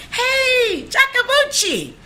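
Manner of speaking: high-pitched yell across a street; imitated scream. Bystander calling to someone across the street, imitated scream. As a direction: holler the name high, stretching it out. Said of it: "somebody across the street screamed".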